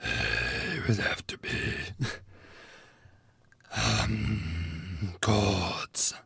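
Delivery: slow, low pumpkin monster voice; a touch of spooky pumpkin monster; pumpkin head. Acted. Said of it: "deep pumpkin monster voice".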